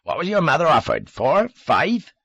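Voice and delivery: loud scorn rising high into questions; scoff, then fire high, loud guesses; mocking disbelief in a country accent; scornful. A narrator's reading. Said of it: "then offered a scornful laugh".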